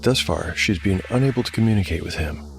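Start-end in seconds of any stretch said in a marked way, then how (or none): none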